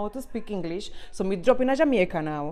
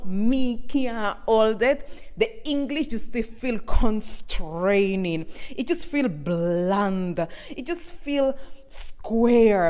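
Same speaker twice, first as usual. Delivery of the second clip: slow and emphatic; much slower than usual. Real, not acted. Emphatic about bland English.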